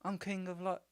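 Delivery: put-on high-pitched New Zealand accent; Korg. Fan playing Korg as kinda the leader, Kiwi accent. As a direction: introduce yourself in a high Kiwi voice. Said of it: "Kiwi accent. Got it".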